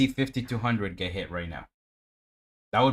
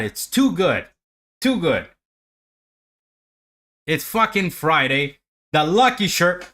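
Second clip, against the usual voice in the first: loud, higher, celebrating the win; hyped up; 3 semitones higher, 4 dB louder than usual. Real, not acted. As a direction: shout it out like the market just paid you. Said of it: "I gotta be hyped up".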